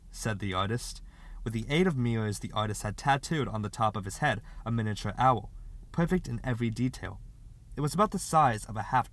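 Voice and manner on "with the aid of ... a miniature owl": usual speaking voice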